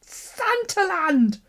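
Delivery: high, slow, drawn-out thrill; delighted surprise. Real, not acted. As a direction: squeal it out slowly with delight. Delighted opening Santaland photos.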